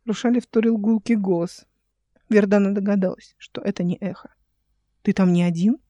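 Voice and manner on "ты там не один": usual speaking voice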